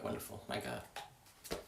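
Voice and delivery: breathy whispered aside. Real, not acted. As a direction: whisper it to yourself. Muttering at own reflection.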